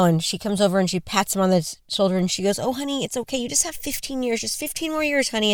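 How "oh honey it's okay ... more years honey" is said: imitating soothing wife. Wife consoling husband, patting shoulder.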